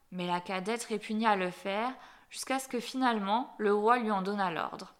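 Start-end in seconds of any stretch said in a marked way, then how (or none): none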